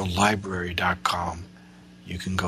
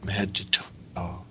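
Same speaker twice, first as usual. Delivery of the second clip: airy breathy mystical healer. Acted. Leads a guided meditation.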